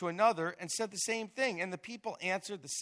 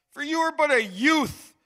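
stern king; 8 semitones higher, 13 dB louder than usual. Acted. The king rebuking the boy David.

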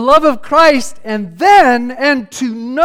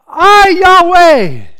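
much higher and louder proclamation; proclaimed; 6 semitones higher, 9 dB louder, much slower than usual. Acted. Preacher voicing God speaking to the listener, ringing proclamation. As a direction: announce the divine name with full force. Voicing God's promise, swelling.

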